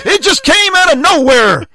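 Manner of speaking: put-on high, loud American accent quote. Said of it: "I was gonna do the accent".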